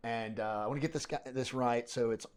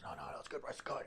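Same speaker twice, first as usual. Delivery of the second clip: softly imitated great voice; 2 semitones higher, 9 dB quieter, faster than usual. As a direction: lightly imitate his voice, keeping it soft. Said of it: "He's got this great voice".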